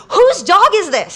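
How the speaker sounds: mock indignant challenge, drawn out; retold angry demand. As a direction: imitate an irate stranger demanding an answer. Angry stranger confronts her about dog.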